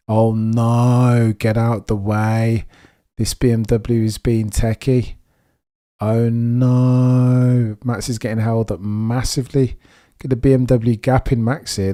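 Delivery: loud, drawn-out cries of dismay; dismayed race reaction. Real, not acted. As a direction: call out in real alarm as the leader gets blocked. Reacts live to car blocking Max.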